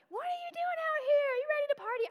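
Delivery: high, loud excited question; party invite. Retold or imitated. Drunk girl leaving bar urges her to party, high and loud.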